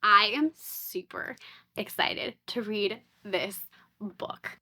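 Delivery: ordinary talk, nothing marked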